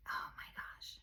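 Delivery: breathless whispered gasp of disbelief; stunned whisper. Retold or imitated. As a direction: whisper it as if reading your old self. Reading her old journal, stunned.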